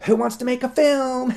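very high, gleeful party-host cry; suddenly happy. Retold or imitated. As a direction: flip from rage to pure joy. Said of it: "all happy and laughing".

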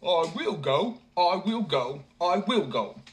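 a stubborn youth insisting over and over; stubborn simpleton. A narrator's reading. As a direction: simpleton nagging his mother. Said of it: "kept repeating".

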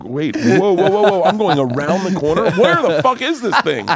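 rising high into comic alarm; hammed-up confusion, climbing in pitch; mock bewilderment. Lost teenager going around the corner, mock-bewildered.

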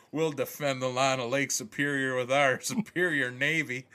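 a hint of a defiant Southern yokel; mock Southern accent. Yooper defending the lake, lightly Southern accented. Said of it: "we're speaking in southern accents".

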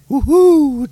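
high whooping cheer; excited cheer. Real, not acted. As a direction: give it a gleeful woohoo. Cheering at John Collins topic.

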